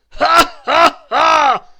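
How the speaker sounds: helpless tickled dragon roaring; booming ticklish dragon laugh. Acted. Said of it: "roared the dragon".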